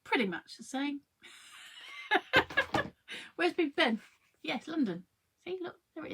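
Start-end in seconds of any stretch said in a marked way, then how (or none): 1.5-3.3 high-pitched burst of laughter. Real, not acted. Laugh after comparing pieces.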